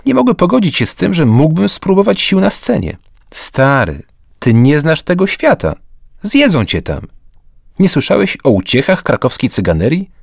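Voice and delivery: a mate lecturing about Kraków's bohemia; lightly voiced worried friend. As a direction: warn him off the bohemian life. Zbyszek warns against theatre life.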